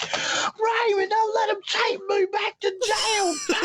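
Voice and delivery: very high mock-crying wail; hammed-up falsetto pleading. Hick woman begging, jail threatened.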